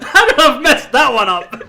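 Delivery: loud, high laughing disbelief. Real, not acted. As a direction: crack up at your own mistake. Laughing at own blunder.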